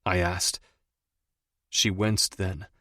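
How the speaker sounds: usual speaking voice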